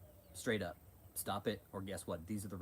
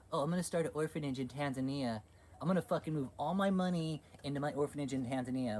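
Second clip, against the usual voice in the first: higher, louder mock scheming; mocking impersonation; 5 semitones higher, 4 dB louder than usual. Streamer voicing a preacher's orphanage money scheme, mockingly. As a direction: put on a mocking voice, raised in pitch.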